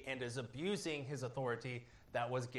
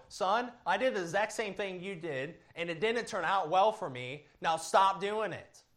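a hint of a stern father, higher and louder by the end; scolding parent; 6 semitones higher, 5 dB louder than usual. Pastor voicing a parent warning his son, mildly stern. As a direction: lightly play the dad telling his son to stop.

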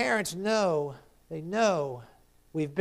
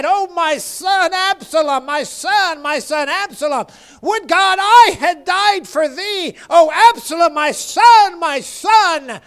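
loud, high lament from the pulpit; impassioned wail of mourning, preached at full voice; David's anguished cry; 12 semitones higher, 15 dB louder than usual. Acted. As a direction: cry out as a grieving father, drawing out the words. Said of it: "the words that David cried out".

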